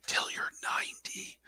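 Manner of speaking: hushed, whispered movie quote; imitated whisper. Fan quoting how Deadpool says it, playful whisper. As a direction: drop right down into a whisper.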